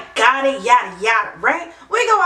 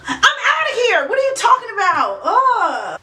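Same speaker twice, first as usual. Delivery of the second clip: laughing exasperation; 7 semitones higher than usual. Retold or imitated. Storming off in retold fight.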